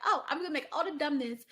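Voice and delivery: a loud, mocking voice for a careless young person; mock dumb thinking. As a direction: sneer it like a reckless kid. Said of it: "It's like".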